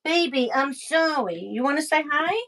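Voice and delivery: ordinary delivery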